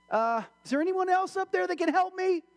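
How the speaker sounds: high mock-desperate plea; pleading. Man on the branch wants another answer, high plea. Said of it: "He's like".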